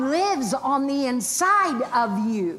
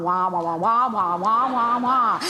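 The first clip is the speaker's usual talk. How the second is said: Charlie Brown's mom; slower than usual. Retold or imitated. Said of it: "it sounded like Charlie Brown's mom".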